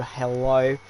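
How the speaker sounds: a quick Australian "hello"; Australian accent. Shown as a demonstration. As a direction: greet them like an Aussie. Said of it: "I went Australian there".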